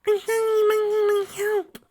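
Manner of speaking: put-on tiny squeaky child voice, very high; happy little filly. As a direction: chirp it like an eager small foal. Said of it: "replied happily".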